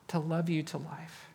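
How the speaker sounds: gentle hushed close; tender hush. Real, not acted. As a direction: drop into a tender near-whisper. Tender sermon moment.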